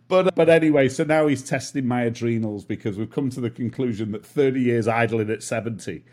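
normal talking voice

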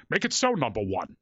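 lightly imitated starship captain; Captain Picard. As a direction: do a hint of a British captain giving orders. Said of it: "I did the Picard".

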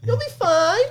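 high, mock-reassuring coax; high teasing voice setting the kid up; egging on. Family setting the kid up for a scare, high mock comfort. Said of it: "They're just like".

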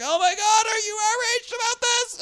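very high squealing parody; shrieking mock post. Mocking every post online, shrill high squeal. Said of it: "Every single post now is like".

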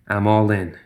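plain reading voice

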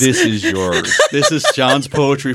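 high, slow laughing reveal. Real, not acted. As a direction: crack up while telling him.

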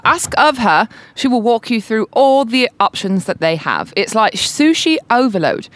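put-on a light British accent on a review read aloud. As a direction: read it like a BBC presenter, drawn out. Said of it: "I can hit you with, like, a British accent".

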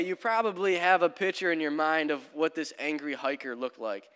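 ordinary talk, nothing marked

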